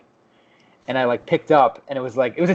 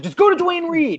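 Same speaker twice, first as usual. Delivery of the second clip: imitating the scammer's exasperated order, pitched way up; high exasperated mock shout; mock angry yell; 3 dB louder than usual. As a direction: yell it like patience has run out. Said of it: "He started, like, yelling at me".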